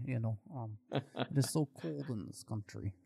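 put-on soft, joking Russian accent; mock Russian lament about the cold, softly; bad Russian accent. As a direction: apologize in a hammed-up Russian accent. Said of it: "that's an awful Russian accent".

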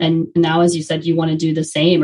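ordinary talk

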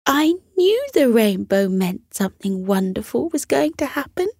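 glowing, told-you-so mum; pleased mother. Acted. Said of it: "said Elliot's mother".

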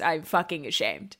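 ordinary talk, nothing marked

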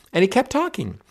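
talking jumps way up, then falls; exasperated high emphasis. Retold or imitated. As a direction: marvel that he kept talking, pitched high. Recounting a talker who won't stop.

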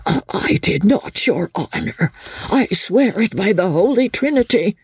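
high, stammering pleading; cowed old man begging to be believed; frightened protest. A narrator's reading. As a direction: swear innocence in a high, panicky voice.

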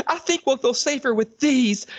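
high put-on girly voice; mock Jessica. Mocking Jessica holding up a knife, high girly voice. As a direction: play the prissy heroine. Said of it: "Jessica goes".

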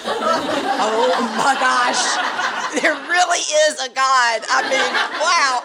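high, drawn-out astonished cry; slow, high-pitched awe bursting into laughter; amazed laughing outburst. Retold or imitated. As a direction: act out being floored, half laughing. Retold astonishment at radio message.

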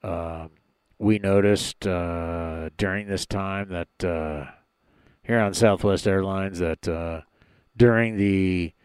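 low, lazy airline captain on the intercom; pilot voice. Pretend. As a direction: talk like the captain announcing to the cabin. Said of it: "that's my pilot voice".

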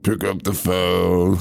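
slow, low demonic drone. Retold or imitated. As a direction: drag it out like a demon. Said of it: "talking in a demon voice".

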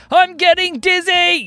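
high, slow, loud call; dizzy yell. A narrator's reading. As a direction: cry out slowly, high-pitched. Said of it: "yelled Spencer".

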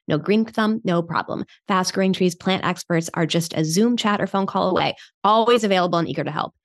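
upbeat sponsor read. Acted. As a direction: sell it like a radio spot. Host reads sponsor ad.

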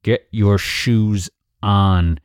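very slow, word-by-word order; losing patience. Acted. Parent repeating order to ignored child, slow and stern. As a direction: spell it out slowly, patience running out.

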